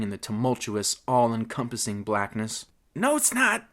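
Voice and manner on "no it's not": high, alarmed gasp. Acted. Petey bouncing against the bars, gasping a correction. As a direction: gasp it out urgently. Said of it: "gasped Petey".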